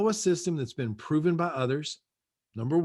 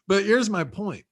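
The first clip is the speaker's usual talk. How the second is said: normal talking voice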